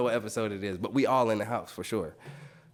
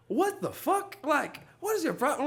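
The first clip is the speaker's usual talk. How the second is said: high-pitched, indignant retort; exasperated comeback. Retold or imitated. Man retorting to the woman who insulted him, high exasperated retort. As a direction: fire back in a much higher voice. Acting out his quarrel with her.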